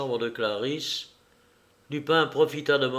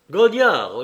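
higher, louder call to a henchman; interpellation; 5 semitones higher, 9 dB louder than usual. Acted. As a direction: call out the name to give orders. Lupin calls his man to orders. (interpellation sonore)

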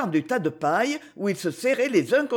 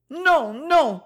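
high, louder frightened no; terrified cry; 8 semitones higher, 4 dB louder than usual. A narrator's reading. Zina crying out to her mother in fear. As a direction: cry out in fresh terror. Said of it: "cria Zina".

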